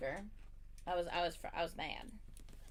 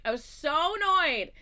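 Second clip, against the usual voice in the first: higher, louder, slow stress on annoyed; emphatic annoyance; 8 semitones higher, 10 dB louder than usual. Shown as a demonstration. Telling friends how annoyed she was, slow loud emphatic. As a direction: drag out how annoyed you were.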